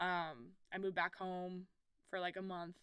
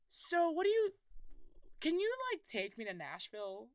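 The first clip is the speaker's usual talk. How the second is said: squeaky high wheedling ask, sliding down at the end; high, eager begging voice; pleading kid self; 9 semitones higher, 4 dB louder than usual. Retold or imitated. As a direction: beg mom like a starry-eyed kid. Asking mom to take her.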